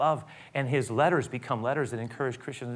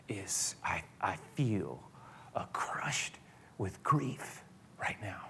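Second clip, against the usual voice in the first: whispered, slow grief; anguished whisper; 4 semitones lower, 10 dB quieter, much slower than usual. Acted. Jesus telling the disciples his soul is crushed, whispered. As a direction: breathe out the anguish.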